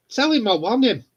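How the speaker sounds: ordinary delivery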